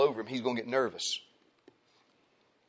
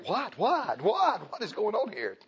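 higher, bewildered repeated what; baffled dad; 7 semitones higher, slower than usual. Retold or imitated. Dad walking in on the crying scene, higher baffled. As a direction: come home and stare at the chaos. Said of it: "Then dad comes home to that".

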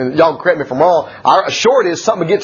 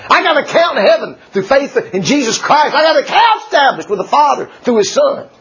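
preaching shout; 8 semitones higher than usual. Real, not acted. Preacher proclaiming faith to the congregation, shouting. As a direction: lift it up and shout it to the church.

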